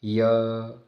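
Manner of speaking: "य" held long and steady as a lone sound; pronunciation demo. Shown as a demonstration. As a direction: stretch "य" so the glide is clear. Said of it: "इसका उच्चारण करते देखो". ("य" का धीमा, खिंचा उच्चारण)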